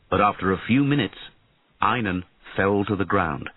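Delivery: ordinary delivery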